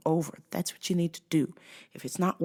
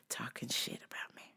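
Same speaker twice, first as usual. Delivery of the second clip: hushed whisper; confiding whisper; 5 semitones lower, 17 dB quieter than usual. Real, not acted. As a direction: drop to a whisper. Whispered aside.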